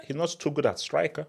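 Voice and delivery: ordinary delivery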